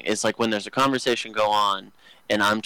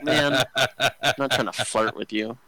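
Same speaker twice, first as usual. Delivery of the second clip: laughing; 2 semitones higher than usual. Retold or imitated. Joking to coworker mid-laugh.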